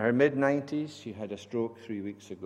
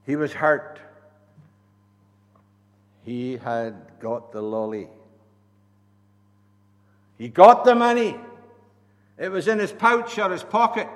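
preaching; 3 semitones higher, 12 dB louder than usual. Acted. Preaching at full force.

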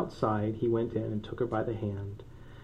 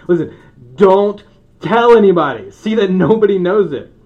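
high, loud mock-stern warning; stern hushed order; 7 semitones higher, 16 dB louder than usual. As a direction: bark the secret order half joking. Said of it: "and you're like".

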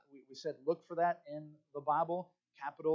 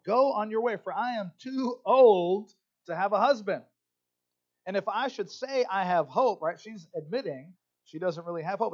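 louder, raised reading of the Bible passage; scripture read with full voice; dramatic scripture reading; 4 semitones higher, 7 dB louder than usual. Acted. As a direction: read it out like a preacher pleading. Reads scripture passage aloud.

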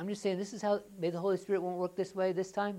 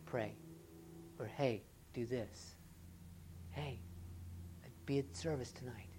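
soft, low and slow inner nudge; still small voice; 4 semitones lower, 9 dB quieter than usual. Retold or imitated. The Spirit quietly nudging a believer to pray, soft still voice. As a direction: hush it like a quiet prompting. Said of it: "that still small voice that says".

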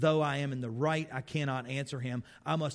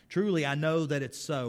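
scripture reading; 2 semitones higher, 4 dB louder than usual. Acted.

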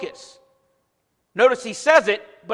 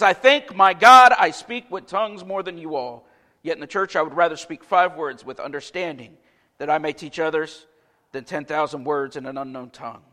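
hushed Bible reading voice; scripture reading; 5 semitones lower than usual. Acted. Reads scripture in the sermon.

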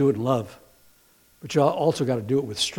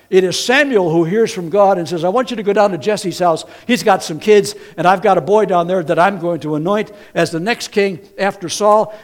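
full-force preaching; 6 semitones higher, 7 dB louder than usual. Acted. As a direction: hammer home who the prophet is.

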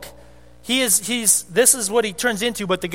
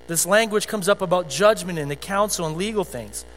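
normal talking voice

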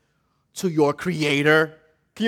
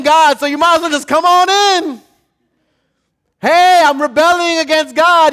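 mock breezy invitation; 10 semitones higher, 7 dB louder than usual. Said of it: "You open the door to the devil and says".

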